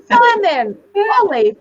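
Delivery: mock furious wife storming off; retold angry exit. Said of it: "I lost it".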